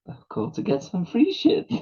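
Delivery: coy Tom voice. Retold or imitated. Tom coyly asking for freebies.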